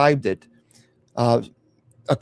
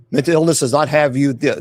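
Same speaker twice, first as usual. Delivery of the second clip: ordinary delivery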